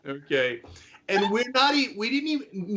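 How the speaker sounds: words broken by real laughter; laughing. Real, not acted. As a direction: laugh through the line in disbelief. Laughing at the clip.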